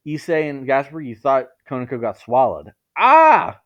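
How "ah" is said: high, loud scream. Acted. Three friends screaming as Konako is swallowed, loud. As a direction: scream in shock. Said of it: "you three screamed".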